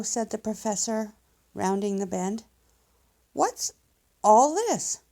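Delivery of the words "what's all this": high, loud startled "what's all this"; a sudden alarmed question; surprised cubs. Acted. Cubs spot a fire.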